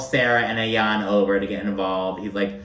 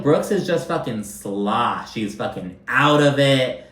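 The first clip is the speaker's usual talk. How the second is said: ordinary talk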